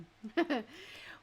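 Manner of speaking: whispered laugh. Real, not acted. Amused at the sports complaint.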